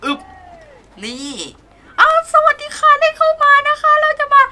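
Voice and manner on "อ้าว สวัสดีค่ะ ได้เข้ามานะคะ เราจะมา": much higher, louder mock female attendant voice; girly greeter voice. Park attendant welcoming customers in a high mock girly voice. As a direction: squeak out a welcome like a sweet park hostess.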